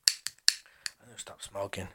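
slow, very soft mutter; hushed, dragging aside; quiet self-reproach. Real, not acted. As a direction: mumble it to yourself, slowly. Muttered aside after losing his thread.

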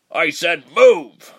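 loud, slow, spat-out order; spat command. A narrator's reading. Soldier ordering Reshma away, loud slow spat command. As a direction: spit the words at her. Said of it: "the second one spat".

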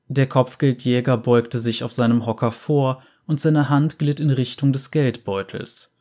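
normal talking voice

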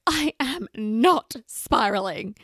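slow, indignant insistence on NOT; mock-offended "I am not spiraling"; defensive denial. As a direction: stubbornly deny it, drawn out. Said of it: "And I'm like".

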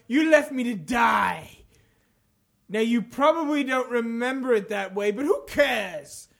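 theatrical villain with drawn-out phrases; hammed-up villain monologue; taunting villain reading. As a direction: taunt them, pausing between phrases. Reads game villain quotes aloud.

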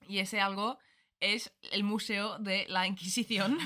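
laughing from nerves; nervous laugh. Real, not acted. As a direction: giggle nervously through it. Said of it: "Me río de los nervios".

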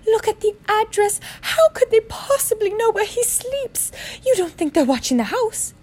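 very high, fearful aunt; quivering voice. Acted. Frightened aunt questions her husband behind the door, quivering. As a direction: quaver anxiously high above your own voice. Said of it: "saying in a quivering voice".

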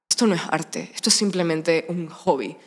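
low, slow, solemn pronouncement; art snob. Retold or imitated. Actress mimicking her purist younger self, solemn art snob. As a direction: declare it gravely, drawing the words out.

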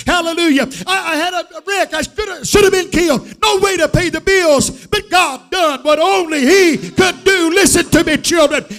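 high, loud revival preaching; fervent, high-pitched pulpit shout; full-force preaching. Real, not acted. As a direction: shout the testimony to the whole church.

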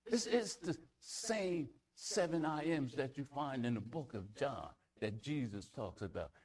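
ordinary talk

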